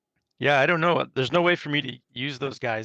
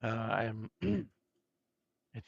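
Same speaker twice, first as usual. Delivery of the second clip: losing voice; 3 semitones lower, 6 dB quieter, much slower than usual. Real, not acted. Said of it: "I'm losing my voice".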